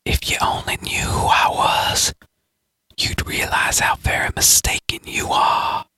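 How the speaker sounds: menacing unvoiced whisper. The stranger's voice whispers menacingly in Galen's mind. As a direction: hiss it softly inside his head. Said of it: "he heard the voice whisper".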